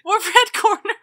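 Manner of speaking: high, loud incredulous question; mock outrage. Co-host challenges her red corner introduction, high loud mock outrage. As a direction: pitch it way up, playfully baffled.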